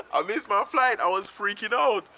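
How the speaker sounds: high, loud and worked up; worked-up confession. Real, not acted. Storyteller confesses missing the flight, worked up and loud. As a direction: blurt it out high, fresh from laughing. Amused confession after laughing at mishap.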